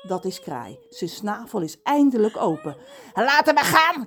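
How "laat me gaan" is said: raised, loud croaking crow; crowing caw. Acted. Crow squawks to be let go. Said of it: "krast hij".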